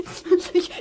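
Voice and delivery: short sly giggle; a smug little laugh. Acted. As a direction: giggle as if you know something she doesn't. Boy laughs at her.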